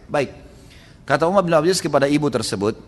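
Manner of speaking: usual speaking voice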